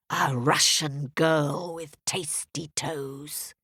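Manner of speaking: slow, hungry witch whisper. A narrator's reading. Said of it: "And she said".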